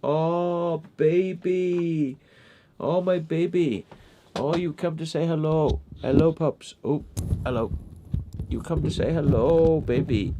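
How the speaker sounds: high cooing greeting to a pet. Real, not acted. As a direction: coo over the dog. Greeting the dog.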